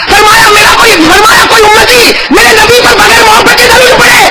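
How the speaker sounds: high, near-crying indignant shout; angry saint. Retold or imitated. Retelling the saint's anger at loveless prayers, high shout. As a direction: voice the saint's outrage. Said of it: "ارے چہرہ غصے میں لال،".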